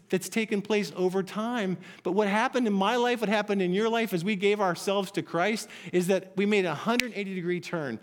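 normal talking voice

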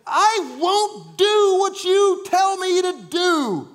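high, loud, very slow furious yell; screaming defiance. Acted. Jonah to his father, slow furious scream. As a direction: spit out each word in rage. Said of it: "Jonah has been screaming at his father saying".

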